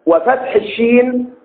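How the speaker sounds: slow dictation. Shown as a demonstration. Teacher dictating the vowel marks slowly to students. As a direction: say وفتح الشين slowly, each sound held. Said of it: "هشيم بضم الهاء".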